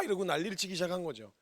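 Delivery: ordinary talk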